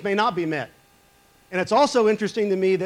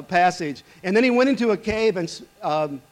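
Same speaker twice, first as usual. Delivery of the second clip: ordinary delivery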